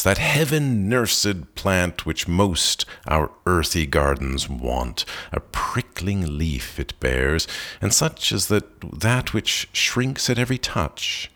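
ordinary delivery

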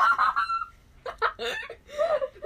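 very high, loud cackling; helpless laughter. Real, not acted. Cold mask smeared on face. (schrilles, lautes Gelächter)

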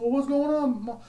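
high, bright retold hello; lightly hammed-up cheerful clerk; cheery greeting. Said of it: "I said".

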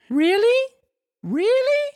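very high squeal of puppet delight; Pinocchio, joyful. Acted. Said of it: "jumping around with joy".

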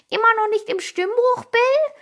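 high, loud mocking girl voice; teasing Sally. Sally mocking Bill's squeaky voice, high and teasing. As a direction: tease him in a high voice.

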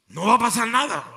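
loud, firm voice of God; serious booming voice. Retold or imitated. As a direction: boom it out with gravity. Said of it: "Así, una voz seria le dijo".

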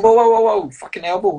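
high startled whoa, whoa; retold startled protest. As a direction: jump up in pitch as the elbow lands. Retelling being elbowed.

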